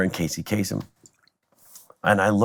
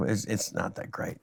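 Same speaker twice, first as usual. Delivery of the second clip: 1 understated put-down; 12 dB quieter, slower than usual. Retold or imitated. Punchline deadpanned to friends.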